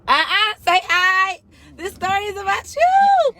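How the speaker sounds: high, drawn-out sing-out. Real, not acted. Telling a child to say hi to the people, high drawn-out call. Calling child to greet viewers.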